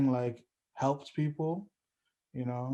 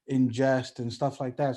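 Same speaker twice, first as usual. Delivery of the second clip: ordinary delivery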